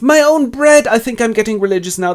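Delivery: high and loud, then a fast quip; overjoyed, tumbling words; rapturous joy. Acted. Robinson rejoicing over his own bread, high and loud.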